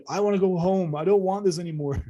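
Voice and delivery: a man at his breaking point begging to go home. Retold or imitated. Lost abductee begs the elder to go home, breaking down. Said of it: "I started breaking down".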